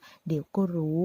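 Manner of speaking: slow, knowing and sly; sly smile. A narrator's reading. Girl hinting at a plan to her friend, slow and sly. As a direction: say it with a scheming smile. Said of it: "ยิ้มมีเลสไนบอกว่า".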